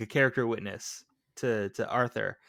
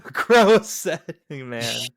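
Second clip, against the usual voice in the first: laughing; 6 dB louder, much slower than usual. Real, not acted. Laughing echo of mocking line.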